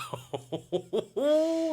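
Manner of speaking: high laugh bursting out; burst of laughter. Real, not acted. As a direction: laugh at yourself. Laughing at own answer.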